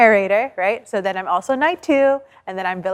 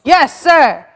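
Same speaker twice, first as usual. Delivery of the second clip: loud "yes sir"; 5 semitones higher, 8 dB louder than usual. Acted. Knights answering the commander.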